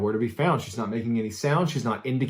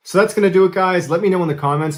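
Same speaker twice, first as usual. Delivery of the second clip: higher, louder cheery outro; bright wrap-up to viewers; upbeat sign-off; 6 semitones higher, 6 dB louder, faster than usual. Real, not acted. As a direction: YouTuber signing off to his viewers, upbeat. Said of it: "outro sign-off".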